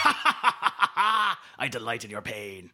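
loud evil laugh into a slow gloat; cackle, then savour the words slowly; cackling villain gloat. Acted. Villain enjoys contestant's failure, cackling gloat.

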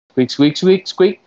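high, loud squeaked question; squirrel talk. Pretend. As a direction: squeak upward as if checking a translation.

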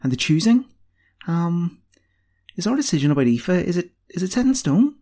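high, halting question; unsure apprentice feeling her way; tentative. A narrator's reading. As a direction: ask hesitantly, stumbling over it. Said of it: "said Roisin tentatively".

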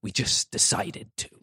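slow, hushed delivery of the line; measured, weighty TV-drama line; quoted dramatic line. Acted. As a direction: let it land slowly, almost breathed. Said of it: "Sam Waterston's there and he goes".

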